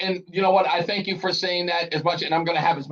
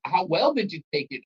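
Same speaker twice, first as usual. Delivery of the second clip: ordinary talk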